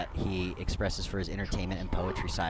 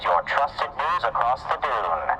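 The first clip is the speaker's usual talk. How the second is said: loud, high news promo voice; news announcer; 4 semitones higher, 4 dB louder than usual. Acted. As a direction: pitch the news like a radio promo. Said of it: "make some announcements".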